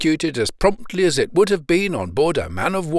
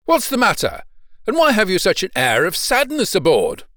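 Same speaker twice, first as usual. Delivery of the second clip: higher, mildly raised questioning call; 6 semitones higher, 4 dB louder than usual. A narrator's reading. Man in a skiff asks a sailor about the sadness aboard, calling out. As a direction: call up with concerned questions, pitch lifted. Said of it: "cried the man in the skiff".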